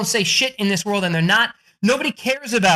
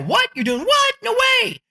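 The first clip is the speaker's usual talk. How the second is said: high-pitched mock disbelief; incredulous reaction. Networking listeners react to your new book, incredulous. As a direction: play an astonished listener, pitched way up.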